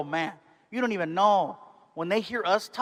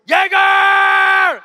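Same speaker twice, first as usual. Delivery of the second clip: huge high bar shout; drinking voice; 12 semitones higher, 11 dB louder than usual. Retold or imitated. Drinker ordering shots, high party yell. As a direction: bellow it like last call. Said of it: "We have our drinking voice".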